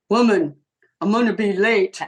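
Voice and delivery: loud, curt call in front of the guys; tough cop husband. Retold or imitated. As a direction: put on a big strong cop act, punching each word. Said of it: "if there were other guys in there, I'd hear".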